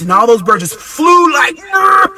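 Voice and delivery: loud, high whirring brr of birds; birds flying off. Retold or imitated. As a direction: shout the flapping burst.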